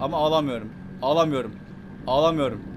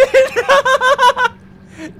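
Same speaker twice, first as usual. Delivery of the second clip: long, high, loud shriek of laughter; scream-laugh in panic; piercing nervous cackle; hysterical laughter; 11 dB louder than usual. Real, not acted. Panic at going deep.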